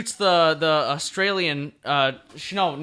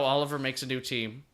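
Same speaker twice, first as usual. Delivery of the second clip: usual speaking voice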